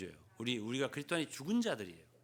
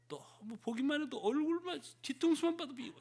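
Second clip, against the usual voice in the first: much higher, petulant whine; a higher, hammed-up grumble of dislike; mock disgust; 11 semitones higher than usual. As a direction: whine like you can't stand them. Mock complaint about hated person.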